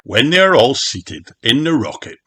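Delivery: ordinary delivery, nothing marked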